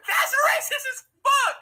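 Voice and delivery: high, loud laughing cry; howling with laughter; laughing shout. Real, not acted. Cracking up at the joke.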